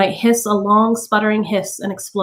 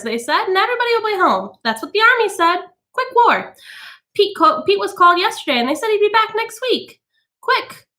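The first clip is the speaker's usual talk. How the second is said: high, breezy gossiping lady; chattering society woman; 11 semitones higher than usual. A narrator's reading. Mrs Phelps chattering to the women about her husband, breezy. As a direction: prattle on carelessly about the war.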